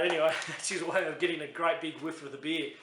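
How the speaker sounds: ordinary talk, nothing marked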